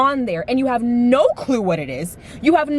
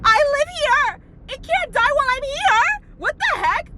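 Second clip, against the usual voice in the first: much higher, indignant and whiny; high mock outrage. Real, not acted. To her dad after his grim empire answer, high indignant outrage. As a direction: squeal it like he betrayed you.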